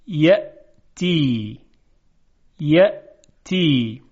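یأتی said very slowly, with a strong, clear glottal stop on the hamza; hamza in yaʼtī said with force. Shown as a demonstration. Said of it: "دقت بفرمایید". (یأتی را آهسته و با نبرِ کامل همزه بگویید)

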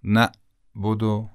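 "نعبد" broken by a glottal stop after نَ; hamza stop. Shown as a demonstration. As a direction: cut the sound dead inside "نعبد". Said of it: "حبس شد".

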